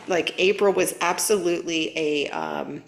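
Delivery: ordinary talk, nothing marked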